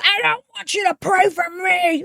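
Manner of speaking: high mocking imitation of the angry woman; mock screecher. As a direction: sneer it in her put-on voice. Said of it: "yelled at by the screecher".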